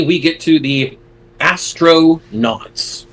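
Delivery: drawn-out "astronauts" with a clear break between "astro" and "nauts"; hyphenated astronauts. Shown as a demonstration. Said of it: "I'm saying it that way because there's a hyphen".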